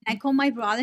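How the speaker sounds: ordinary delivery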